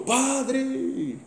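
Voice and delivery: high, drawn-out call from afar; imitated distant call. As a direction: imitate someone hollering across a room. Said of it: "gritou de longe".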